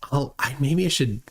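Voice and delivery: ordinary talk, nothing marked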